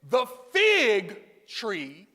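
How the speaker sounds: "fig" drawn out long and loud before "tree"; stress on fig. Shown as a demonstration. Preacher reminding congregation of the emphasis, long heavy "fig". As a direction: punch and stretch the word "fig". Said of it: "emphasize the word fig in fig tree".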